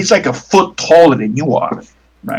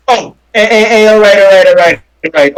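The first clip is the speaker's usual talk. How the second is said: flustered; 6 semitones higher, 7 dB louder than usual. Acted. Mobster panics at coughed blood.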